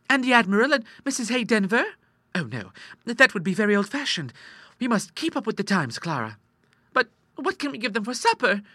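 put-on high-pitched, eager young ladies' chatter; girlish sisters plotting. Sisters planning a daring supper, high eager voices. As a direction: plot gleefully in girlish pitch.

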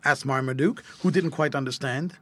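plain reading voice

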